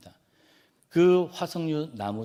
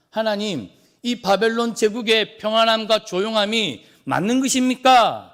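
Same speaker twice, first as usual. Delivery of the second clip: raised, louder protest as an angel challenging God; higher-pitched, mildly angry appeal; slightly angry; 7 semitones higher, 9 dB louder than usual. Acted. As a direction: confront God with an indignant, loud question. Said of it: "살짝 화가 난 투로".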